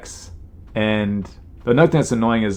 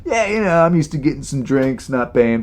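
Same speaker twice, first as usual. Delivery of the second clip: smug bragging; 4 semitones higher than usual. Pretend. Mock bragging first class.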